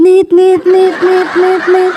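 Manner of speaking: imitated high engaged tone; mimicked disconnect tone; hung-up phone beeps. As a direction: beep repeatedly like a dead line. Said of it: "Teleponnya langsung putus".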